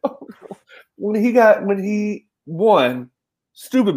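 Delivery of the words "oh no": breathless whispered oh no; whispered dismay. Retold or imitated. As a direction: whisper it in shock. Emotional reaction to hero's death.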